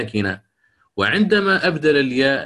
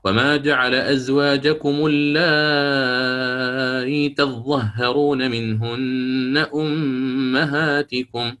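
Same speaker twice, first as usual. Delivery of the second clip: slow chanted tajwid recitation; تلاوة مجوّدة; Quranic recitation; slower than usual. Shown as a demonstration. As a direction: recite it melodically, with eased hamza. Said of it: "فيقرأ".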